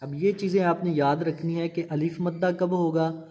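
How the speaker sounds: ordinary talk, nothing marked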